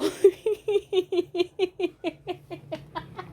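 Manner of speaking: high, staccato giggles trailing on; long giggling fit. Real, not acted. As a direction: giggle helplessly in a long run. Cracking up after her own joke.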